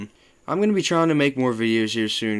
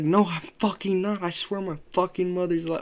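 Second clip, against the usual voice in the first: high-pitched insistent protest; defensive to a friend who doubts him; 8 semitones higher than usual. Retold or imitated. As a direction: insist you're not lying. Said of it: "getting defensive".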